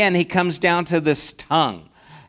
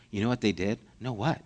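mock gossip; 8 semitones lower, 9 dB quieter, faster than usual. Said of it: "I'm being facetious and kind of making fun of it".

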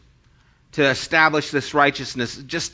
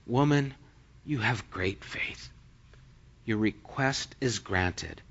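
soft, lowered, tender tone; gentle granting; 5 semitones lower, 11 dB quieter than usual. Retold or imitated. Jesus answering the woman's faith, gentle granting. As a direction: speak gently and low, with pauses.

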